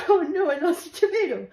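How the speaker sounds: high, giggly oh no; fake-tragic through laughter; laughing mock dismay. Teen mourning a tomato, laughing.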